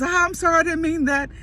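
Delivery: high-pitched contrite sister; embarrassed apology. Retold or imitated. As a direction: imitate a sister sheepishly apologising.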